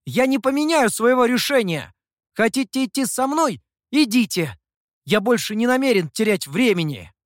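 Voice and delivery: high, loud declaration; impatient, heated ultimatum; defiant insistence. A narrator's reading. Youth stubbornly refuses, urges leaving.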